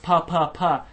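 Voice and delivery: "pa" tapped out crisply three times; showing the Greek alliteration, crisp repeated "pa"; alliteration demo. Shown as a demonstration. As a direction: pop each "pa" as a separate plosive beat. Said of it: "Pasa parabasis kai parakoi".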